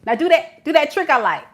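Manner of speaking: high, loud mock command to a pet; commanding a dog. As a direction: order them about like a trained dog. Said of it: "you like, sitting back".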